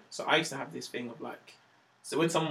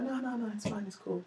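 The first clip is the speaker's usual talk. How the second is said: put-on girlfriend's light reassurance; 6 semitones higher, 8 dB quieter than usual. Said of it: "She was like".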